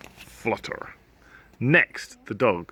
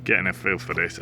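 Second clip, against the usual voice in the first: plain reading voice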